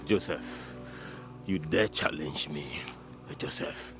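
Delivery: very low, slow, near-whispered threat; quiet menace. Acted. Offended man warning Joseph who dares challenge him, quiet menace. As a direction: drop right down into a hushed warning.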